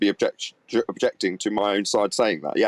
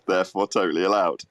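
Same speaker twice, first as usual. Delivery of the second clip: ordinary talk, nothing marked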